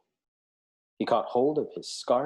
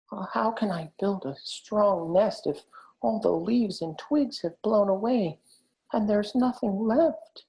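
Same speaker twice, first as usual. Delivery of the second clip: higher, slow, anxious hedgehog; anxiously; 11 semitones higher, 4 dB louder than usual. A narrator's reading. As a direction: ask it worried, near to fretting. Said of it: "he asked anxiously".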